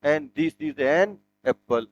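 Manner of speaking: very slow, soft, spelled-out teacher read; a slow dictation to the class; slow model sentence. Shown as a demonstration. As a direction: model it slowly word by word. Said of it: "तो क्या आ जाएगा?".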